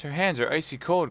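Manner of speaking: mild panic; alarmed cry. Acted. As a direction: fear for her. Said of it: "cried D'Artagnan".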